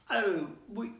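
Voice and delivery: nervous, halting stammer; frightened boy stammering an excuse. Acted. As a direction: stumble over the words, caught out. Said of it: "Ron stammered".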